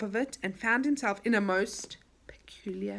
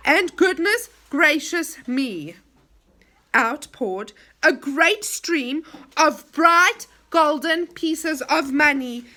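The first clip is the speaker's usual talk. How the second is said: slow, loud and higher, full of wonder; amazed; 5 semitones higher, 6 dB louder, slower than usual. A narrator's reading. Narrator marvelling at the pouring gold, slow and loud. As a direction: let the wonder spill out slowly.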